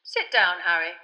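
politely firm, wanting to move on; Fudge, testy. A narrator's reading. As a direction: Fudge hustling Harry inside. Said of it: "said Fudge".

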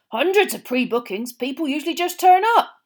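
the owner grumbles to Mr Weasley about odd campers; grumbling campsite owner. A narrator's reading. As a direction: grumble about the weirdos on your field.

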